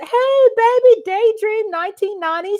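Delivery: high, loud quoted greeting; imitating greeting. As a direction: replay the viewer's excited hello. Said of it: "He said".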